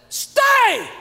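high loud single-word command; commanding shout. Retold or imitated. Old friend ordering the pastor at breakfast, commanding shout. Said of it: "looked at him and said".

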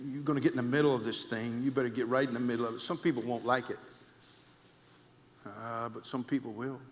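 ordinary talk, nothing marked

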